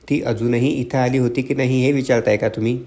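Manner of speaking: ordinary delivery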